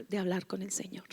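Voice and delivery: soft remorseful whisper. Acted. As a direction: confess it in a hush. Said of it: "susurro en la prédica". (susurro arrepentido)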